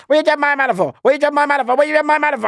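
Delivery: high-pitched hammed-up yelling; imitating the son yelling at the door; mock yell. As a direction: squeal it over and over like a panicked kid. Officer's son yells at door.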